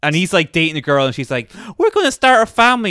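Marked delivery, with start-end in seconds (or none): 1.8-2.9 mock girlfriend voice. Girlfriend quoted in movie recap.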